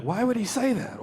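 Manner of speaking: high, puzzled viewer voice; mock bafflement. Viewer tuning in late, mock bafflement. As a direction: ask it in exaggerated confusion.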